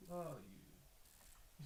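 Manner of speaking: slow, soft, seductive purr; sexy lady's voice. Retold or imitated. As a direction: breathe it slowly like a siren. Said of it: "sexy lady's voice".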